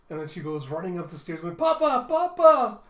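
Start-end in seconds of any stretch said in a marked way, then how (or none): 1.6-2.8 put-on high, loud child calling out; excited high-pitched holler; shouting. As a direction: yell for your dad at the top of your voice. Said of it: "Why are you shouting?".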